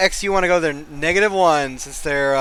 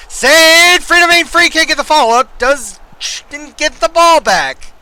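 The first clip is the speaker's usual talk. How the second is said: much higher, much louder commentary yell; excited play-by-play shout; 11 semitones higher, 10 dB louder than usual. Acted. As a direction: scream the save like a commentator. Commentating a dramatic save.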